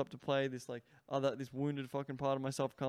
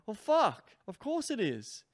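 high, louder excited exclamation; the penny drops, higher and louder; sudden realization; 8 semitones higher, 6 dB louder, slower than usual. Retold or imitated. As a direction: burst out with a eureka moment. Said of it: "you'll start going".

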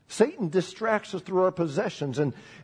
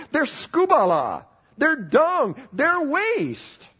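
rising emphatic preaching; 11 semitones higher, 5 dB louder, much slower than usual. Acted. Preacher building to climax.

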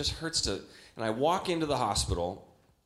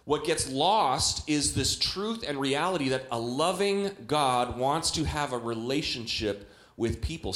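normal talking voice

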